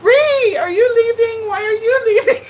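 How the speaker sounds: high, loud, hammed-up crying shout; mock wail. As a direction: wail after a friend who's leaving. Viewer Ree saying goodbye.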